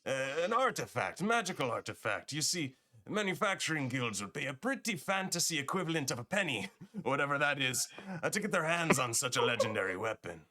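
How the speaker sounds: high, swooping merchant patter; put-on accent that wobbles as he explains; slipping merchant accent. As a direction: start high on a hurried denial, then pitch the job. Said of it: "I'm losing the accent".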